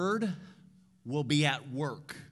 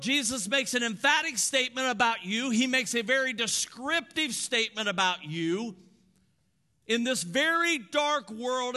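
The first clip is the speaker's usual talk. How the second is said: loud, high preaching; preaching at full force; 5 semitones higher, 4 dB louder than usual. Acted. Pastor declaring to the church, full-force preaching.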